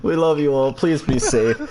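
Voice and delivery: laughing, upbeat announcer outro; podcast outro. Acted. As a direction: sign off like a radio host, laughing.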